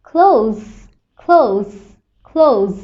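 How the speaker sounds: ক্লোজ, s said as z; z sound in close. Shown as a demonstration. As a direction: finish ক্লোজ on a buzzing z. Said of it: "এরকম sound হবে".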